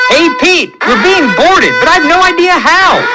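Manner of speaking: high, loud alarmed shout over the alarms; urgent shout. A narrator's reading. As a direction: yell the warning to Pete across the ship. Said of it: "Ryder shouts".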